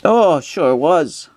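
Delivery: high, slow character reply; put-on character voice. Man agreeing with Stanley about the storm, high slow voice.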